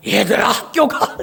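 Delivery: slow straining call with almost no voice; voiceless strained call; hoarse voice. Retold or imitated. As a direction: push out a voice that won't come. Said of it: "목소리가 안 나오는 거야".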